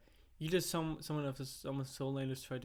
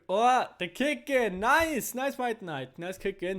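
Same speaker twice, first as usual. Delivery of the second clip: high, loud hype shout; excited shout; 8 semitones higher, 12 dB louder, slower than usual. Real, not acted. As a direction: cheer the play at full voice. Caster reacts to big play.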